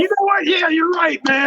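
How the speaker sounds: high squeaky shout, pretend fan giving in; high mock shout. Mocking Wisconsin fans, conceding in a high shout. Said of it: "And they'll be like".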